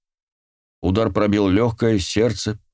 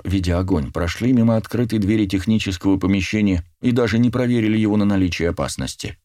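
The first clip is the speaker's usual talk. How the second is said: ordinary delivery, nothing marked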